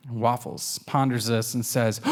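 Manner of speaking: ordinary delivery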